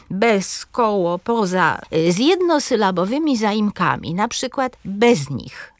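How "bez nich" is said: "bez nich" as one word, BEZ-nich; slow "bez nich", leaning on "bez"; stress on BEZ. Shown as a demonstration. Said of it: "Akcent pada na pierwszy wyraz". („bez nich” z akcentem na BEZ)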